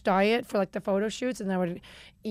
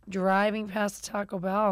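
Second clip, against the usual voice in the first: ordinary talk, nothing marked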